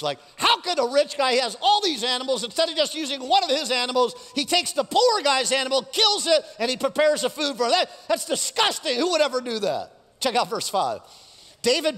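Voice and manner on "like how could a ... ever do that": high-pitched, indignant rant on the king's behalf; outraged. Acted. David reacts to the stolen lamb, outraged. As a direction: protest in outrage, punching the worst parts. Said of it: "he's like, he's outraged".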